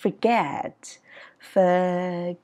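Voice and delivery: "Forget" drawn out, "get" sliding down; English sliding intonation. Shown as a demonstration. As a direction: glide through "Forget" slowly, falling at the end. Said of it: "ngữ điệu trượt lên và trượt xuống". (đọc "Forget" trượt lên rồi xuống)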